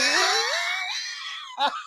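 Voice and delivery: high shrieking laughter. Real, not acted. Burst of laughter after punchline.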